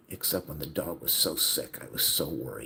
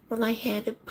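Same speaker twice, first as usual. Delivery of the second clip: much higher, louder mock voice of his mother; 5 dB louder than usual. Son mimicking mother's careless answer, mocking high voice. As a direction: imitate her dismissive reply in a shrill put-on voice.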